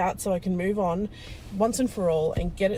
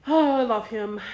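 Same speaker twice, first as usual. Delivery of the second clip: tearful gush; 3 semitones higher, 6 dB louder than usual. Real, not acted. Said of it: "second vlog I have cried in".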